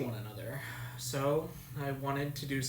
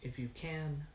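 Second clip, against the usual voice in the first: soft, lower intimate whisper; 6 semitones lower, 11 dB quieter than usual. A narrator's reading. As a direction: whisper it close to her ear.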